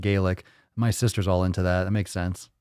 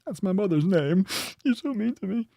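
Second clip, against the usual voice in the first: much higher, whiny hurt voice; mock wounded high whine; 11 semitones higher than usual. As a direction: whimper it like an offended child. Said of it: "You're like".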